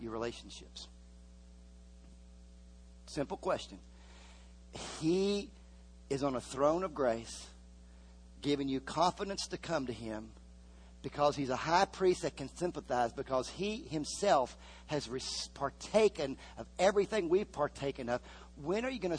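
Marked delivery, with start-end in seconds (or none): none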